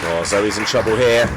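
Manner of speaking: normal talking voice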